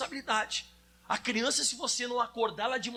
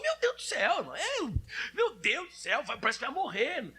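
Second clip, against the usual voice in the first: a whiny believer falling apart, pitched way up; panicked wail; 3 semitones higher than usual. Retold or imitated. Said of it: "a pessoa".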